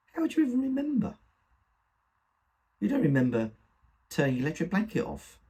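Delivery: high-pitched disbelief, coming down as it goes on; indignant challenge. Acted. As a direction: challenge her, starting high and quick. Son challenges mother's memory indignantly.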